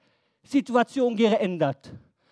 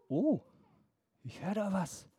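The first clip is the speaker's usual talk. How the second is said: quiet wonder; 10 dB quieter than usual. Acted. Jesus notices the shouting.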